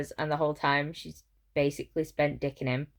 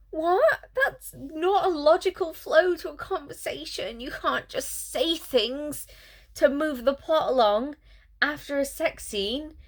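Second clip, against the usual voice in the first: higher, put-on voice of disbelief; mock exasperation; 8 semitones higher than usual. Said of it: "I'm like".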